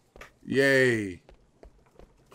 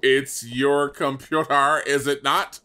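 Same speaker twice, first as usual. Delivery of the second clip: slow and deliberate; slow, pointed repeat. Real, not acted. Player pressing partner on whose computer it is, slow pointed delivery. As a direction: spell it out word by word.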